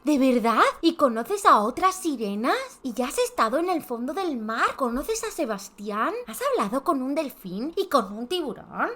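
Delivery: high, loud gasping questions; little girl thrilled about mermaids; excited little girl. Acted. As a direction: tumble out the questions in delight. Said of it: "Un grito ahogado de alegría".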